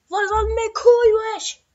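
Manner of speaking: sudden high, loud burst at the neighbours; high, loud exasperated yell; annoyed outburst. Real, not acted. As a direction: snap at the noisy neighbours.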